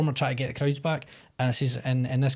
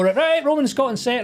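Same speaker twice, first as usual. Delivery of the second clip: calling out; 11 semitones higher, 4 dB louder than usual. Retold or imitated. Crew calling them to set.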